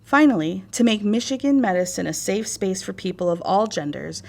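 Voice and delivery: ordinary talk, nothing marked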